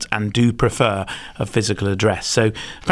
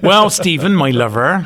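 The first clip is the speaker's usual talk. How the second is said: put-on West Country accent; 6 semitones higher, 4 dB louder than usual. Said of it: "love the accent".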